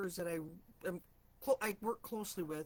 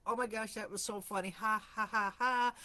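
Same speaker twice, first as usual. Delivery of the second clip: retold laughing coworker; 4 dB louder than usual.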